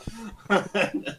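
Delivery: high, far-off evil laugh; sinister cackle after the kill. Acted. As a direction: let the dagger spirit cackle. Dagger voice laughs on.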